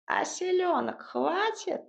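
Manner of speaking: high, drawn-out taunting question; snide old witch. A narrator's reading. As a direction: needle him slyly in a high witch's voice. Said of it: "ехидно спросила Яга". (ехидный голос Яги, высоко и врастяжку)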